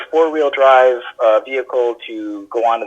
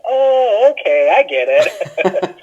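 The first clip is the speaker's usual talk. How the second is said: mock friends; 8 semitones higher, much slower than usual. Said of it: "they're like".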